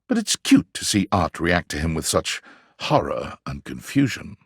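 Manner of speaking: normal talking voice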